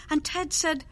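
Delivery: usual speaking voice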